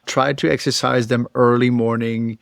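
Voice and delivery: usual speaking voice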